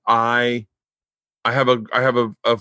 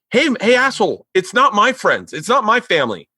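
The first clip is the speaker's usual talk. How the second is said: high, exasperated telling-off; mock-yell at the crew, pitched way up; heated retold rant; 11 semitones higher than usual. Retelling his outburst at the flag handlers, heated high telling-off.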